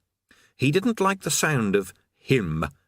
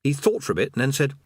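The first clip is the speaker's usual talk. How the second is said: ordinary delivery, nothing marked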